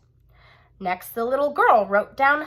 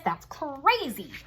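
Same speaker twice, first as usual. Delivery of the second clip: usual speaking voice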